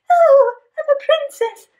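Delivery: high, loud, swooning pretend princess. Storyteller playing princess with the kids, princess voice.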